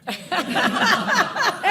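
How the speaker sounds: big loud burst of laughter. Real, not acted. Laughing at own joke.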